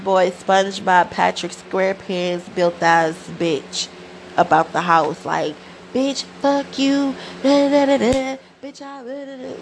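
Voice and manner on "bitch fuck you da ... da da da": mock cousin taunt. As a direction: squeak out the cousin's insult like a cartoon. Retold fight, cousin yelling.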